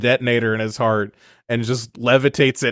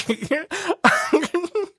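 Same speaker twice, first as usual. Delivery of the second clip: real high giggle. Real, not acted. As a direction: let a squeaky giggle out. Laughing at the movie.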